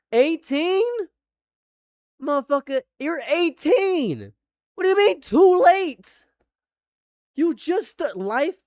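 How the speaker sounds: loud, high disbelief, stretching out each word; incredulous rant. Real, not acted. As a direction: yell it like you can't believe the excuse. Ranting at an eighteen-year-old fan.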